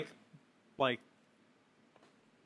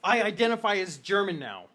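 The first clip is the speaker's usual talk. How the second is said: man's voice; 3 semitones higher, 7 dB louder than usual. Acted. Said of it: "you hear a man's voice saying".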